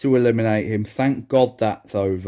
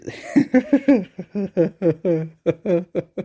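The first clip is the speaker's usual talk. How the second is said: helpless laughter; 7 semitones higher than usual. Real, not acted. Laughing at awful wrestling.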